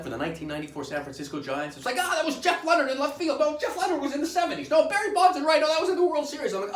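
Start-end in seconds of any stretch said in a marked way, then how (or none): 2.0-6.5 high, loud panicked babble; frantic self-distraction. Acted. Patient recalling ballplayers during procedure, frantic panicked shouting. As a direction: shout out names to escape the pain. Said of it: "trying again to divert my attention".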